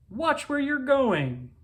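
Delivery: stern principal. Acted. Principal scolds bumping boy.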